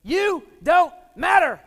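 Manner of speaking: very high, loud, slow shout; scream. Retold or imitated. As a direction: scream it down at the kid on the floor. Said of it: "Screams at me".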